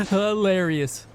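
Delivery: drawn-out mocking chuckle; mocking laugh. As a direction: laugh right in his face. Mocking his knockout video.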